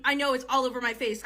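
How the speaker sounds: ordinary delivery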